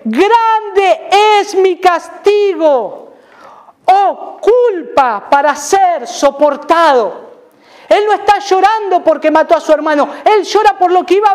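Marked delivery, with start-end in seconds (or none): 0.0-7.3 very slow, drawn-out lament; lamento de Caín. Acted. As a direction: stretch each word like a man crushed by punishment. (lamento lento y arrastrado de Caín)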